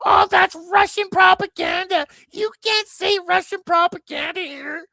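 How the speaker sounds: hammed-up high mock voice of the banning host; mocking impression. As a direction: shriek it in a scornful put-on voice.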